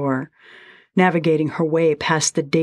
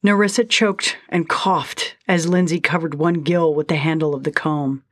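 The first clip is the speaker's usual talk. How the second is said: ordinary delivery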